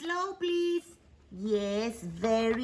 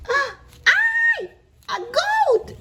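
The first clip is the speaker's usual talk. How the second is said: startled shout; 5 dB louder, much slower than usual. Acted. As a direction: gasp then a high shout of surprise. Discovers injured goat.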